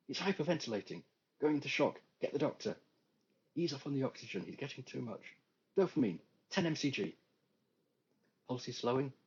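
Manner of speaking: clipped emergency-room commands; urgent medical orders. Acted.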